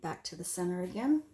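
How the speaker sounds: ordinary talk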